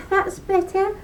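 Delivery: high little voice, lightly wheezed; wheezing leprechaun. Acted. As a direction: catch your breath on it. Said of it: "wheezed the leprechaun".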